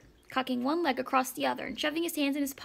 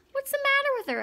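high-pitched, eager pupil; eager question; 12 semitones higher, 4 dB louder than usual. A narrator's reading. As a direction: ask it eagerly, pitched well up. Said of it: "asked Roy Hart eagerly".

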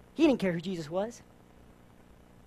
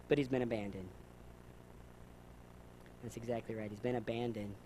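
much lower and softer; hushed, grave; 10 semitones lower, 16 dB quieter than usual. Real, not acted. Preacher dropping low to stress the man was abandoned, hushed. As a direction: let "abandoned" sink in quietly. Sombre drop after peak.

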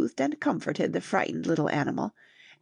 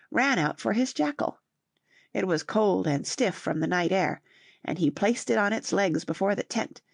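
usual speaking voice

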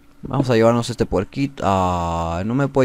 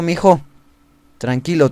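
ordinary delivery

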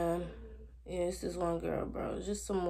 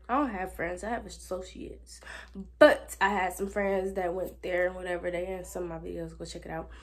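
ordinary talk

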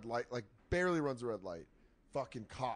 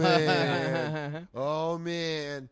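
loud, stretched-out groan, higher pitched; drawn-out dismay; 4 semitones higher, 11 dB louder, much slower than usual. Retold or imitated. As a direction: moan it in mock dread. Groans in retold police stop.

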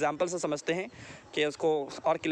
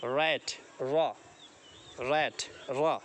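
slow sound drill; 2 semitones lower, much slower than usual. Shown as a demonstration. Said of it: "sound पे ध्यान दें".

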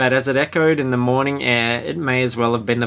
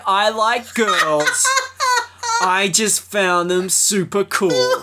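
high, slow laughing read; laughing while reading; 7 semitones higher, much slower than usual. Real, not acted. Reading the fan's line, breaking into laughter. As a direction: barely hold it together laughing.

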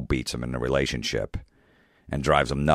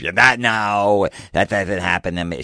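mock heckler girl; 5 semitones higher than usual. Girlfriend denying the bit, whiny mock voice. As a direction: protest like an offended drunk. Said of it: "the girl will be yelling at me".

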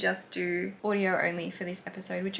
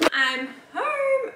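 high, louder sing-song arrival call; cheery high call of I'm home; 13 semitones higher, 4 dB louder than usual. Real, not acted. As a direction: announce you're back to the house.

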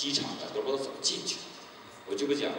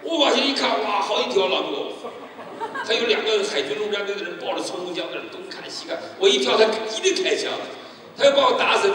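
loud, much higher storytelling rush; excited retelling; 9 semitones higher, 8 dB louder than usual. Retold or imitated. Fugitive recalling armed guards below the wall, loud and excited. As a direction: build the danger, louder and higher. Looking down at dogs and guns.

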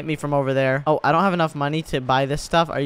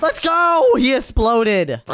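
high, loud victory cheer; triumphant shout; 9 semitones higher, 4 dB louder, much slower than usual. Real, not acted. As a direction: celebrate the kill at the top of your range. Enemy finally blown up.

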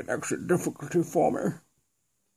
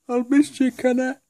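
hammed-up tearful goodbye from a tiny figure; weepy little toy; 11 semitones higher than usual. Toy crying, voiced as goodbye.

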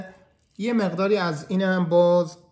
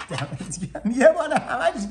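laughing; 7 semitones higher than usual. Real, not acted. Laughing at the helper's deadpan answer, amused. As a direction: laugh through the thanks.

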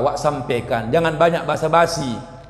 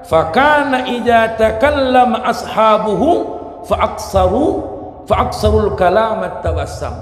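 Arabic hadith recitation; 7 semitones higher, 5 dB louder than usual. Acted.

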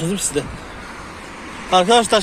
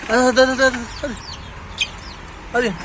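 high coaxing call to chicks; herding call; 6 semitones higher, 4 dB quieter, faster than usual. Real, not acted. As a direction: urge the chicks on, pitched up. (civcivleri yüksek sesle yüreklendirerek)

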